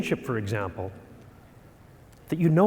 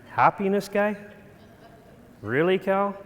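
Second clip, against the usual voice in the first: lightly voiced doubtful boss, amused; a touch of mocking disbelief; incredulous boss; 2 semitones higher than usual.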